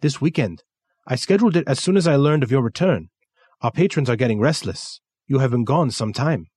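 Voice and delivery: put-on brisk Kensuke voice with his accent; Japanese accent.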